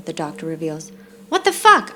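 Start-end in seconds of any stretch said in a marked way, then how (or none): 1.3-1.9 a much higher, louder outburst; exclaiming. Acted. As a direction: let the shock burst out. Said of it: "I exclaim".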